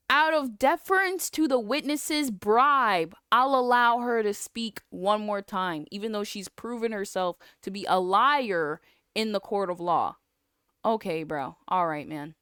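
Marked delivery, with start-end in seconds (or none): 0.1-10.2 loud, exaggerated mock ruling; scornful sing-out, settling toward the end; indignant mockery. Mockingly rewords the judge's line.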